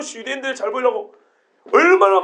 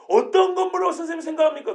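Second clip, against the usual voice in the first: indignant; 5 semitones higher, faster than usual. Shown as a demonstration. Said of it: "도대체 이런 말이에요".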